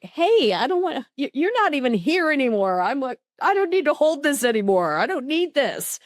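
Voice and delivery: high-pitched, indignant refusal; mock protest. Retelling how they refuse to carry it, mock protest.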